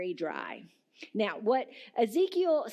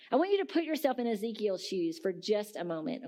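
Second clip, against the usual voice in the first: ordinary talk, nothing marked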